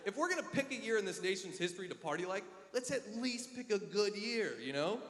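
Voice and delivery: ordinary talk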